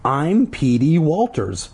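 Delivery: mildly theatrical insistence; insistent. A narrator's reading. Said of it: "Paul insisted".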